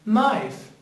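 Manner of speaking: "knife" said with no K sound; silent K. Shown as a demonstration. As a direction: say "knife" starting straight on the N. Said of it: "the letter K here is a silent letter".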